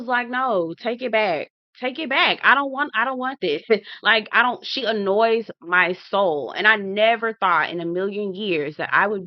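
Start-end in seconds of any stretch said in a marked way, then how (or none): 0.3-3.6 high, pleading protest; whiny refusal. Retold or imitated. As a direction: beg them to take it back. Rejecting new cast member.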